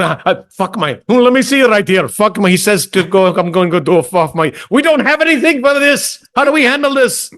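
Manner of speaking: a rep searching his script in a panic, flustered and rising. Retold or imitated. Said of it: "hear him flipping through the script going".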